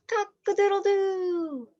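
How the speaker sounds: playful rooster imitation; rooster crow. A narrator's reading. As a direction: crow it for the kids. Teacher voices rooster sound.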